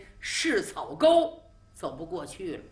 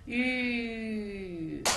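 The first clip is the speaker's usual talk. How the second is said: horse whoa; 5 dB quieter than usual. Acted. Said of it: "柴少突然一学战马".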